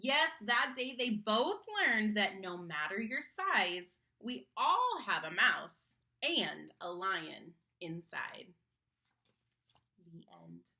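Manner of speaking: put-on lively sing-song reading to kids; story narration. A narrator's reading. Reading a picture book to a child, animated storytelling. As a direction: read the rhyme aloud to children with bounce.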